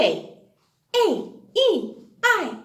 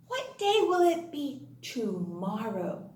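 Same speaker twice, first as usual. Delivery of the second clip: ordinary delivery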